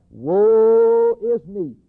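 long, loud, high wail on woe; anguished cry. Retold or imitated. Prophet seeing the Lord, long anguished cry. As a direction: cry out, stretching the first word right out. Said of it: "And he cried".

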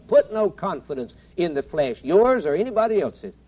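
preaching at full force. Acted. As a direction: preach it out like a battle cry.

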